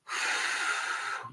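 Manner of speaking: long breathy blow-out sigh; audible exhale sigh. Shown as a demonstration. Coach showing viewers the breath noise, audible sigh. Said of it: "make the noise".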